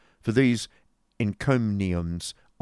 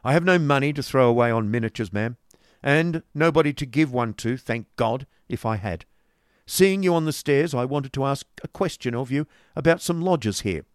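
put-on louder, brusque old moneylender; stern businessman; 6 semitones higher, 6 dB louder than usual. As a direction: lay it down hard and brusque. Ralph voiced through dialogue.

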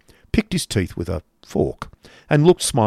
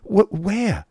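high, stammered question; startled cry; 11 semitones higher than usual. A narrator's reading. Nephew hearing his mother is here, startled. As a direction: stumble on the word, then lift it high. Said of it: "cried Nicholas, looking hastily around".

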